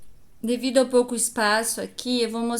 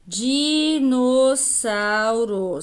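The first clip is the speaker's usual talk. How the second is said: slow syllable-by-syllable reading; 3 dB louder, much slower than usual. Shown as a demonstration. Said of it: "teacher sounds out word".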